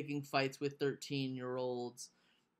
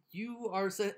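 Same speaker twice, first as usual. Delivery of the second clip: ordinary talk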